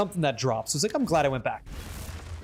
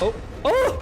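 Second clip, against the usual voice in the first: high sudden double oh of surprise; startled oh; 12 semitones higher than usual. Real, not acted. Streamer seeing a viewer's mirror drop, startled. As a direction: caught off guard by the drop.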